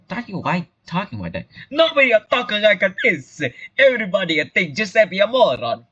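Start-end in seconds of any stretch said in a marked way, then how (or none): 1.7-5.9 put-on indignant, higher broad Italian accent. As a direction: protest high in stage Italian. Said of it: "talk like an Italian stereotype".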